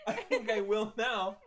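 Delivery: helpless laughter breaking up the words; high, laughing wail; laughing to tears. Real, not acted. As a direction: talk through real laughter, nearly crying. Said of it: "You sound like you're about to cry".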